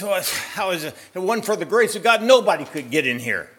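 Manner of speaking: high, loud desperate outburst; man giving up on points; exasperated cry. Acted. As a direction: throw up your hands in despair. Man gives up, throws up hand.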